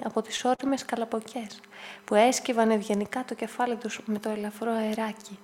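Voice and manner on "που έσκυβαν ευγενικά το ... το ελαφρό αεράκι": plain reading voice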